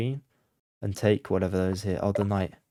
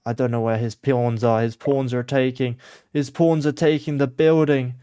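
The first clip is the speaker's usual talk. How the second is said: building louder with each repeat; exasperated outburst; 6 dB louder than usual. Real, not acted. Player who has lost track of pawns, rising loud exasperation. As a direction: start firm and swell into loud exasperation.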